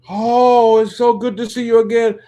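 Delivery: loud, high-pitched gushing welcome; effusive greeting. Retold or imitated. Store owner greeting Whitney on her return, loud effusive delight. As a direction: gush like a delighted host greeting a star.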